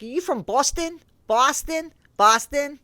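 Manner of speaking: high, questioning stabs at a Boston accent; playful, not-quite-there Boston accent; attempted Boston accent. Shown as a demonstration. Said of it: "I'm not great with accents".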